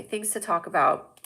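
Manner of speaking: usual speaking voice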